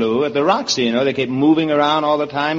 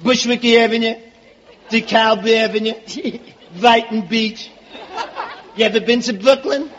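high-pitched, proud Brooklyn native; hammed-up Brooklyn boaster; Brooklyn accent; 6 semitones higher than usual. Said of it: "Oh, you come from Brooklyn?".

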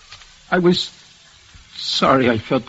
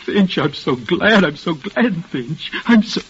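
grieving; 4 semitones higher than usual. Acted. Reliving friend's death.

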